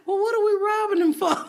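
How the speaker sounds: high, loud mock bewilderment; baffled question. Comic to crowd ready to rob, baffled retold question. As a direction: act out a puzzled cry to a mob.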